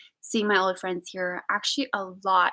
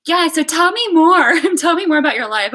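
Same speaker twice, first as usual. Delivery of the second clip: high, loud, gushy prodding; overeager interest; 8 semitones higher, 10 dB louder than usual. Retold or imitated. Friend catching up over coffee, gushing with eager interest. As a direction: imitate an overenthusiastic chatty friend.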